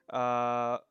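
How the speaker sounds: sheepish laughing at herself; embarrassed laugh. Real, not acted. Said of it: "I guess it's failing".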